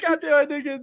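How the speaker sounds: high, whiny mock tantrum; spoiled brat. Kid without the wished-for Game Boy, spoiled brat whining. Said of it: "I was acting like a spoiled brat".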